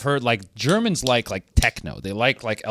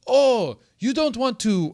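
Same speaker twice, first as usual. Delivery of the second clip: mock German accent, pitched much higher; 10 semitones higher, slower than usual. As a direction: put on a playful German accent to imitate the guy. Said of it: "that's my German accent".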